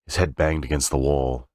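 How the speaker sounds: usual speaking voice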